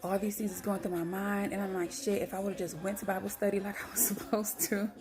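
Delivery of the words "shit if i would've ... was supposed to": laughing self-blame. Retold or imitated. Blaming herself over skipped Bible study, laughing. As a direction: chuckle at your own guilt.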